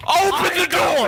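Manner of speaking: high yell to open the door; panicked shout. Real, not acted. Panicked calling out in game.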